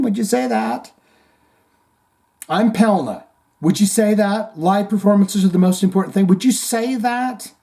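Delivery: high, pushy mock interviewer. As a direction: press the question like a reporter. Plays interviewer asking Owens.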